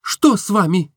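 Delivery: put-on surprised young woman asking; Nastenka puzzled. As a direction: ask what is wrong. Nastenka curious.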